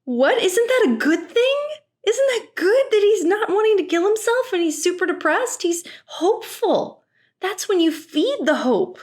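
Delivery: incredulous. Real, not acted. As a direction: squeak with stunned disbelief. Outraged reaction to Teal.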